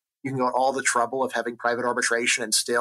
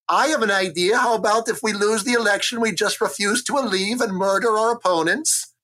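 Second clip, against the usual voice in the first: modern politician; 8 semitones higher, 3 dB louder than usual. Acted. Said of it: "someone says".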